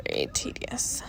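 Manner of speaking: breathy whispered complaint; whispered aside. Real, not acted. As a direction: whisper it under your breath, worn out. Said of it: "whisper heard".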